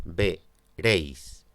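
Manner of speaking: "veréis" divided as "ve-réis", diphthong in one beat; split into syllables. Shown as a demonstration. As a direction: count out "veréis" in two separate beats. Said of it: "la palabra tiene dos sílabas".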